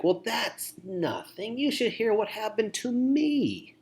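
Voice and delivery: high, put-on voice, slow start; mock one-upping. Poor listener one-upping a person, exaggerated mock boasting. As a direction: top their story, hammed up and high. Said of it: "one-upping a person".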